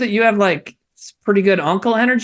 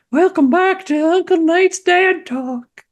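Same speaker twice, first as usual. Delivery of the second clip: high, slow creepy welcome; creepy show host; 11 semitones higher, slower than usual. Acted. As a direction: put on a creepy host voice, drawn out. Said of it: "you guys said I sounded creepy".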